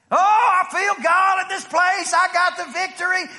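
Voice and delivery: mock ecstatic shout. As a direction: ham up a churchgoer shouting he feels God. Mocking excited believer.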